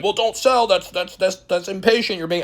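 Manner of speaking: high, whiny parody of cautious fans; sing-song mockery of the "don't sell" crowd; mocking whiny viewer. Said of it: "you guys are saying".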